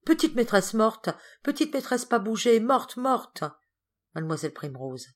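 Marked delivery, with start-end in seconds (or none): 0.0-3.4 high-pitched, alarmed wail in words; distressed cry. A narrator's reading. Servant finds his little mistress lifeless, distressed cry. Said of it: "il se précipita vers elle en poussant un cri".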